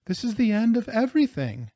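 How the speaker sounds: high, plaintive acted wail; despairing. A narrator's reading. Rosarita thinking all is lost, despairing. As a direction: pitch it up in hopeless woe.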